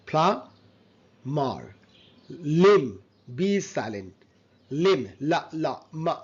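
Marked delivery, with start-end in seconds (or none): 0.1-1.6 "প্লামার" very slow, PLA... MAR; slow model of "প্লামার" with no b; syllable-by-syllable demo. Shown as a demonstration. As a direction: split "প্লামার" into two drawn-out syllables. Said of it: "but it's a plumber".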